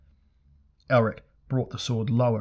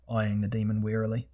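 ordinary delivery

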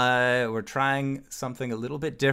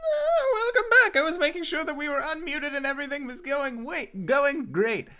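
high-pitched welcome back; 13 semitones higher than usual. Real, not acted. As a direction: greet the audience back way up high. Host returns from break.

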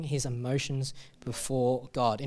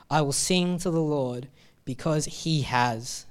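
slow, tender scripture reading, drawn out; slow devoted reading; slower than usual. Acted. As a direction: linger on each word of trust. Reading verse five slowly.

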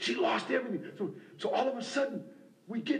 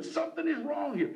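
ordinary talk

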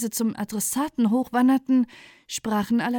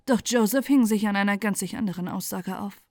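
plain reading voice

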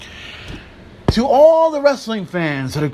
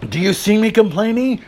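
usual speaking voice

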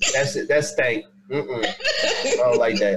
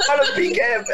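higher, echoing "Butter pecan" in surprise; amused disbelief; 11 semitones higher than usual. Real, not acted. Friend echoes Lisa's nut word in amused surprise. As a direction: repeat "Butter pecan" as if you can't believe it.

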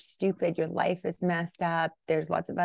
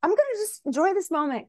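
high, louder self-talk; forced cheer; 12 semitones higher, 5 dB louder than usual. Retold or imitated. Parent choosing patience over flipping out, high-pitched forced cheer. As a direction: pitch way up, talking oneself into enjoying it. Retold choice to enjoy the moment.

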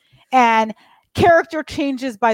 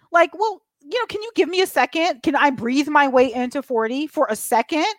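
ordinary talk

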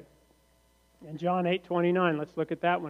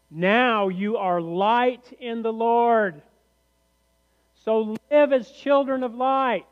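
raised, louder voice proclaiming a verse; declaimed scripture reading; 5 semitones higher, 5 dB louder, slower than usual. Acted. Preacher proclaims Ephesians to the congregation, raised and emphatic. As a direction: proclaim it slowly and loudly from on high.

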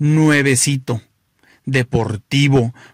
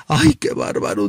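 much higher, dramatic camp wail; flamboyant guy exhausted; 13 semitones higher than usual. Acted. Sweaty flamboyant guy arriving at the finish, high dramatic wail. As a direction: moan it theatrically. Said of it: "le dice al cuate del carro".

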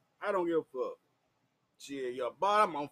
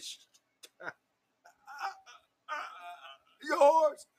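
high, broken stammer into a squeal; unable to talk; 10 semitones higher than usual. Retold or imitated. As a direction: act too overwhelmed to get the word out. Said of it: "I couldn't even fucking talk".

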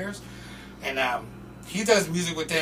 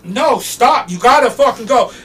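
raised, forceful retold order; stern security guard; 7 semitones higher, 10 dB louder than usual. Security throwing out the fan, stern. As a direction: cut him off and send him away.

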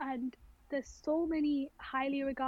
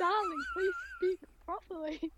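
higher, mocking proper-English voice; 8 semitones higher than usual. Answering rough school accents in a higher mock-proper voice. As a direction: play a higher, mock-refined correction. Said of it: "And it's like".

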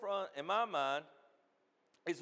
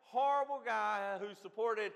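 raised, loud pulpit delivery; impassioned preaching; 6 semitones higher, 7 dB louder than usual. Real, not acted. Preacher mocking a false view of God, impassioned. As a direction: preach it forcefully, pitched up.

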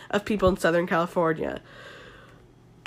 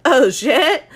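louder, higher mock SoCal exclamation; Connor's SoCal accent; 5 semitones higher, 6 dB louder than usual. Podcaster attempting Connor's accent with the flu, mock SoCal yelp. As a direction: attempt a surfer dude's surprised reaction. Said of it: "That was me trying to do this accent".

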